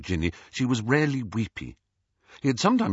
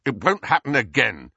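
loud, higher, hard-edged retort; harsh; 4 semitones higher, 5 dB louder than usual. A narrator's reading. Harry shuts down Ron's accusation, harshly. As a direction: cut him off forcefully. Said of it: "said Harry harshly".

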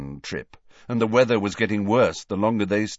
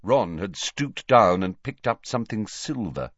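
ordinary delivery, nothing marked

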